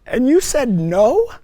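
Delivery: loud, high, disbelieving; can't believe she refused; incredulous protest. Retold or imitated. Man protesting to Mary that she refused, loud disbelief.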